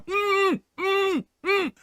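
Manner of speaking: high closed-mouth "mm" grunts; sewn-shut mumbling hum. Acted. Zombie walking around with mouth sewn shut, high muffled hums. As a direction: hum in high bursts as if the lips were stitched. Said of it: "his mouth is sewed shut".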